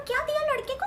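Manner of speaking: gossipy auntie. Retold or imitated. Aunt retells quoted gossip.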